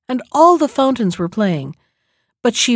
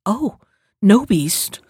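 ordinary delivery

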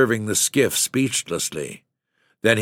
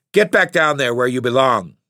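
put-on a loud, raging scream at the boy; 5 semitones higher, 5 dB louder than usual. Enraged father ordering son down, screaming. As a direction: bark the order down at him in fury. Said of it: "he screamed".

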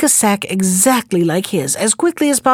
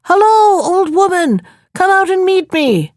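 calling from afar; 9 semitones higher than usual. Acted. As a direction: call out to someone far off. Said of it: "he began to shout from a distance".

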